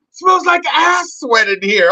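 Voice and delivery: high, drawn-out mock complaint; imitating disgusted wife. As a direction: complain as the wife, pitched well up and slowed. Wife complains, voiced by husband.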